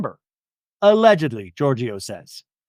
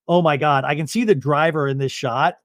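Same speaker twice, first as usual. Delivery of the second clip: usual speaking voice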